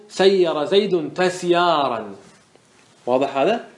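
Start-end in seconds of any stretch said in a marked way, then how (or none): 0.1-2.1 slow emphatic demonstration. Shown as a demonstration. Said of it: "فقل".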